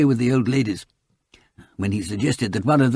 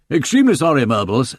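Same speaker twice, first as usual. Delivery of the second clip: hearty, louder, higher-pitched apology; apologetic counsel; 4 semitones higher, 7 dB louder than usual. A narrator's reading. Learned counsel arriving late to Murbles, apologetic. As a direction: burst in with an emphatic apology. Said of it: "full of apology".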